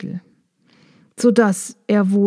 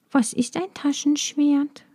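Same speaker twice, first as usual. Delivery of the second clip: little bee voice; 6 semitones higher, 5 dB quieter than usual. Acted. Maya asks curiously.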